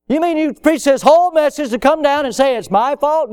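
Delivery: high-pitched mock protest; objecting congregant.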